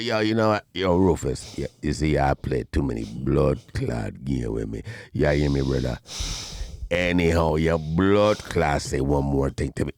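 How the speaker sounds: deep, low Jamaican warning; Marlon's Jamaican accent. Retold or imitated. Warning Rufus before a fight, low Jamaican accent. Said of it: "So Marlon said".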